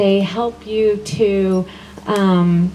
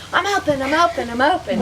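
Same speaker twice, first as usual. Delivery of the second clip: much higher, bouncy ad-style chant; commercial jingle voice; 7 semitones higher, faster than usual. Retold or imitated. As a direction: sing it like a TV commercial. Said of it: "just like the commercial".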